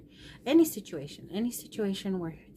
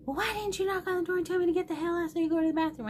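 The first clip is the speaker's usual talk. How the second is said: high, fast imitation of an exasperated son; retold angry scolding; 11 semitones higher, 3 dB louder, much faster than usual. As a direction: fire off the son's reproach in one rush. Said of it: "So he's mad".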